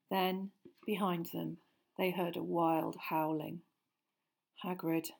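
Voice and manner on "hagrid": muttered. A narrator's reading. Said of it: "Harry muttered".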